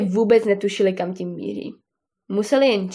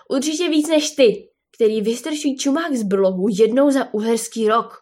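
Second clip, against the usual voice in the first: plain reading voice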